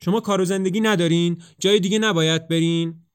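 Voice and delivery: angry shout at the mocking pair. A narrator's reading. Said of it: "خشمگین".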